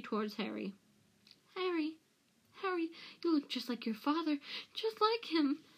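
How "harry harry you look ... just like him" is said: high, wheedling plea; fawning Pettigrew. A narrator's reading. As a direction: wheedle in a much higher voice. Narrator voices Pettigrew fawning on Harry.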